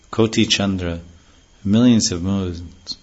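ordinary talk

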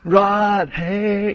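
high, drawn-out call across distance; imitated huge voice. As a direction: call the name out long and high. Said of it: "huge voice".

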